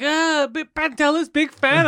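high, slow, beaming fan voice; delighted fan greeting a comedian; friendly fan. Retold or imitated.

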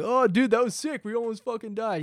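stoked bro shrugging off death; frat bro. Retold or imitated. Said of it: "And they were like".

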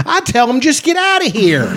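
put-on high, broad Michigan accent. Imitating the celebrity's Michigan accent. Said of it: "she's got a Michigan accent".

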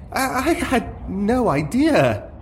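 high, slow, stammering panic; panicking. Acted. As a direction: stutter out a frightened excuse. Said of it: "Valerian was visibly panicking".

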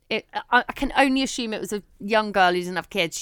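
ordinary talk

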